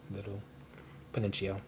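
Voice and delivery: usual speaking voice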